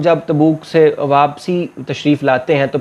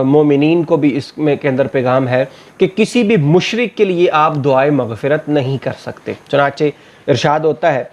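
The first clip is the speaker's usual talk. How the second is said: ordinary talk, nothing marked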